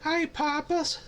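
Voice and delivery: very high, odd put-on greeting; cute cat voice. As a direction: go way up high and silly. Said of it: "she could say".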